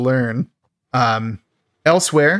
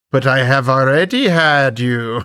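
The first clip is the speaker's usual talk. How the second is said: slow, put-on creepy suitor voice; Creon. Recapper playing Creon answering the woman who refuses him, creepy impression. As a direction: draw it out as a mock villain. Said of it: "And he's like".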